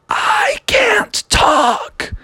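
silent high whisper, slow; mouthed. Acted. Voiceless princess mouthing to Froggy, whispered. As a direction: mouth the words with barely any voice. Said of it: "she mouthed out the words".

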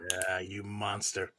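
low, slow, soft stern dad. Acted. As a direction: scold like a tired father. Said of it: "that's my dad voice coming out".

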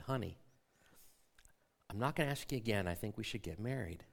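low, soft and measured; reenacted hushed, very calm sincerity. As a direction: drop the voice right down and speak earnestly. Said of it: "I lowered my voice, and I said very calmly".

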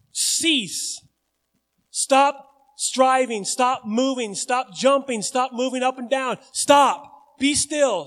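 stop, stop, stop, rising loud; firm commands. Retold or imitated. Jesus silencing wind and waves, loud commanding. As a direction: order the sea into stillness.

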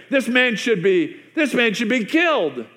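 David demanding the man die; king demanding death, outraged. Retold or imitated.

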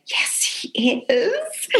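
hushed, breathless little answer; timid whisper. Retold or imitated. New waitress timidly admits to the bartender who's there, whispered. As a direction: whisper it as if awed.

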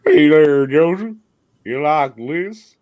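loud, booming mock greeting, rising at the end; put-on greeting voice. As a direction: belt out a hammy greeting to your co-host. Silly greeting bit, co-host.

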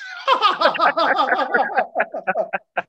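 long, high peal of laughter; uproarious laugh. Real, not acted. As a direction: burst out laughing at the punchline. Cracking up at own punchline.

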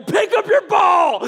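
high, slow yelled command at the wife; retold scream. Husband on the golf course screaming at his wife, slow high shout. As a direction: bark it out like a frustrated golfer losing patience. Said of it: "and I screamed at her".

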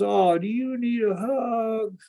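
lightly imitating the hurting woman offering a hug; the woman asking. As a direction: voice her coaxingly, drawn out. Voicing the woman in story.

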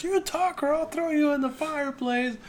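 high, strained mother's threat; threatening mother. Retold or imitated. Mother threatens doll at fireplace, high strained threat. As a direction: squeal the threat like a desperate mother. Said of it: "holds Chucky up against the fireplace and says".

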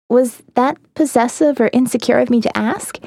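ordinary delivery, nothing marked

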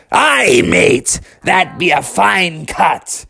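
high, hollered pirate cheer; pirate talk. Acted. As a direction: crow approval like a storybook pirate. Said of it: "all the pirate talk you want".

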